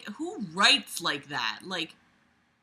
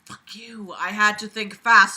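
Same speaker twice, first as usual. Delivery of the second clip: ordinary talk, nothing marked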